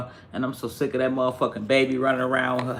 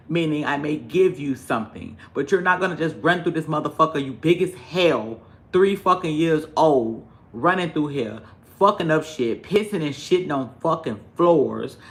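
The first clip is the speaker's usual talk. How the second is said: usual speaking voice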